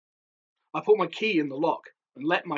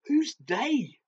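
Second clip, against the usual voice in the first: scared; 8 semitones higher than usual. A narrator's reading. Scared boy asks.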